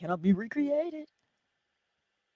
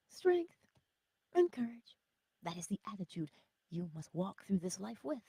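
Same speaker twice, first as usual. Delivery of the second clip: usual speaking voice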